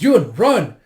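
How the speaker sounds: high, very loud warning; urgent shout. Acted. Yona tells Yun to flee soldiers, urgent shout. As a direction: yell it to get them moving.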